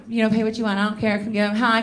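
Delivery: usual speaking voice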